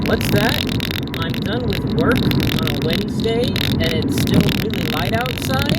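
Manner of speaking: high, loud, delighted surprise; excited. Real, not acted. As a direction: gush about the good news. Excited about daylight after work.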